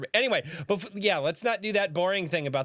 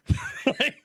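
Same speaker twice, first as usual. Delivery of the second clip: high laugh; 4 dB louder than usual. Real, not acted. Laughing at own rant.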